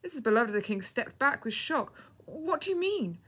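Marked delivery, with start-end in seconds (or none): 2.3-3.2 much higher, startled question; taken aback and flustered; flustered, very emotional. A narrator's reading. Shocked woman questioning the girl, flustered. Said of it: "quite flustered and very emotional".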